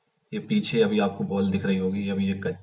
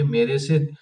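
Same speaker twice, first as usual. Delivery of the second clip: indignant outburst; 5 semitones higher, 6 dB louder than usual. Real, not acted. Indignant about neighbours' meters.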